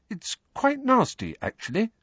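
high, controlled voice; forced calm. A narrator's reading. Hermione breaks bad news to Harry, with forced calm. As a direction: keep it calm with an effort. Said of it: "in a voice of forced calm".

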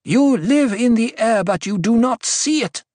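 high, pointed scolding; sharp rebuke. A narrator's reading. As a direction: reprove her with sharp authority. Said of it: "sharpness in her voice".

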